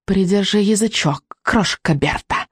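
slow hissed warning; hissing witch. Acted. Witch silencing a woman, hissing. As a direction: hiss it slowly, like a witch. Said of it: "быстро прошипела ведьма".

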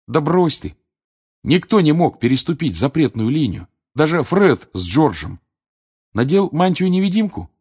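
disbelieving. Acted. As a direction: refuse to buy the excuse. Friend scoffs in a brewing quarrel.

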